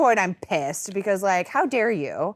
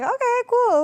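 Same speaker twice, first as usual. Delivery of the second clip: high mock okay; 6 dB louder than usual. Retold reaction to singing.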